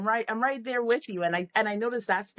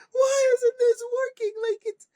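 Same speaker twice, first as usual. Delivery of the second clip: very high, loud mock-crying wail; frustrated; 4 dB louder than usual. Coach imitating kids whose catapult failed, frustrated. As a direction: imitate a kid wailing at the injustice. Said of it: "she got frustrated".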